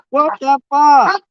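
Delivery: fed-up grandma venting; drawn-out exasperation. Real, not acted. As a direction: stretch out the outrage. Reacting angrily.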